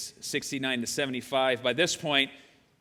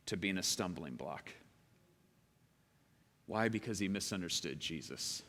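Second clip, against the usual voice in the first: much softer, lower, unhurried sermon voice; soft reflective preaching; 5 semitones lower, 5 dB quieter than usual. Acted. Pastor to congregation, hushed reflective preaching. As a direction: draw them in quietly.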